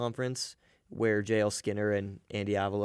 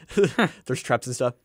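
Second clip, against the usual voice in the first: laughing; 7 dB louder, slower than usual. Real, not acted. Laughing at own joke.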